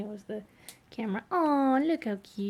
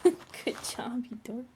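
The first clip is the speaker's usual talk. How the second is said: whispered praise. Real, not acted.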